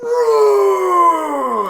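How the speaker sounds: long, high, loud mock roar; Kylo Ren roar. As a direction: roar in hammed-up villain rage. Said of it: "in the Kylo Ren voice".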